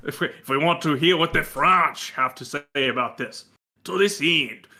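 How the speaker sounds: hammed-up French corporate statement; bad French accent. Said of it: "You sound like a Spanish Japanese trying to do a Jamaican accent".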